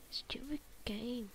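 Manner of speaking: whispered complaint; annoyed whisper. Real, not acted. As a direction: grumble it under your breath. Muttering in annoyance.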